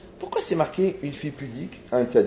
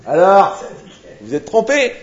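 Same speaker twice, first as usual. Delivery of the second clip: loud, higher gloating exclamation; mock triumph; 6 semitones higher, 10 dB louder, slower than usual. Young man showing the rabbi he was wrong, acted triumph. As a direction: crow it out in imitation.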